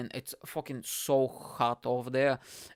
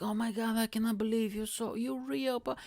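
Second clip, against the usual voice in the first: much higher, mock-gushing boyfriend; gushing excitement; 10 semitones higher than usual. A man meeting his online girlfriend at last, mock-gushing. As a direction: imitate him gushing in a high voice.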